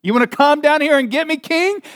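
swaggering mock challenge; cocky taunt. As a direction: goad the king like a brash tough guy. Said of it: "we're like".

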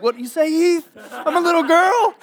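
mock offended disciples. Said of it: "They're like".

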